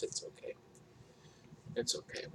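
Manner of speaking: breathy whispered aside. Real, not acted. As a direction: whisper it to yourself, resigned. Muttered resigned aside about deafness.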